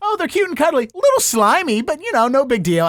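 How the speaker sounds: high-pitched doting voice; playful cooing. Pretend. Host playing along about baby aliens, playful cooing. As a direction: gush over something cuddly, easing down.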